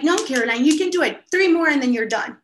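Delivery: insistent push. Retold or imitated. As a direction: press her hard to finish. Coach pushes swimmer again.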